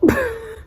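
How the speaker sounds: sudden high giggle at the caller; shrieking giggle; high burst of laughter. Real, not acted. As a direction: squeal of a laugh.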